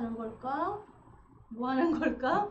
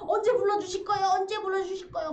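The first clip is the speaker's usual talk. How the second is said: impatient plea; 6 semitones higher, 4 dB louder, faster than usual. Retold or imitated. Impatient plea to God, quoted.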